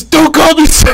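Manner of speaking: mock drill sergeant shout with a laugh; drill sergeant yell. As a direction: yell it, cracking up midway. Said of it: "He goes".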